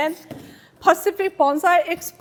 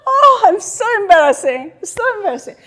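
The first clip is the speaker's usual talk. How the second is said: higher, louder squeal of mock embarrassment; mock embarrassed visitor; 7 semitones higher, 6 dB louder, faster than usual. Imitating a visitor fussing over the display, mock embarrassed. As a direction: play a flustered visitor gushing. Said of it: "People come to Bonsai Museum".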